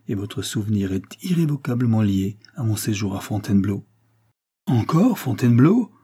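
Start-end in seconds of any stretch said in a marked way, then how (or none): 4.6-5.9 louder, higher outburst of disbelief; exclaiming. A narrator's reading. Lady Weymouth crying out at the count, exasperated. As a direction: cry it out, exasperated. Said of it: "s'écria lady Weymouth".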